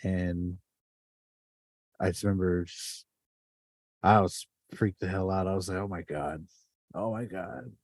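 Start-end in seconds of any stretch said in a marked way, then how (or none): 5.8-7.7 soft, breathless oh my God; hushed retold fear. Shaken teen after fleeing the building, very soft and hushed. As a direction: relive the shock in a hushed voice.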